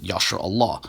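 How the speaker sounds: "Yasher Allah" as YAH-sher ah-LAH; proponents' pronunciation. Shown as a demonstration. Lecturer quotes what proponents say, demonstrated pronunciation. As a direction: say "Yasher Allah" with open AH vowels. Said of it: "rather they pronounce it".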